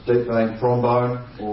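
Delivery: ordinary talk, nothing marked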